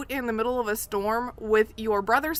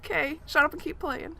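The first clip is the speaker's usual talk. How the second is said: high, amused mock aside to herself; noticing, then dismissing; 7 semitones higher than usual. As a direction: point it out then brush it off, raised pitch. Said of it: "I was like".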